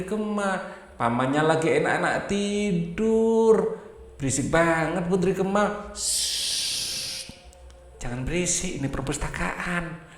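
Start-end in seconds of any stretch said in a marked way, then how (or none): 5.9-10.1 whispered shush, soft warning; hushing librarian. Acted. Librarian uncle hushing the noisy princess, whispered. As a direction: hush her sharply in a library whisper.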